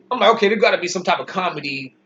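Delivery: normal talking voice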